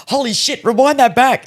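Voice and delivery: high excited holy shit; urgent shock at the tape. Retold or imitated. Telling her to rewind, high and shocked.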